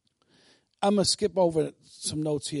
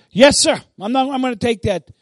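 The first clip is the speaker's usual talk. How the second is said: loud, raised, decisive reply; obedient soldier response; 4 semitones higher, 8 dB louder than usual. Acted. Believer answers God's command, firmly. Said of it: "Just as you say that".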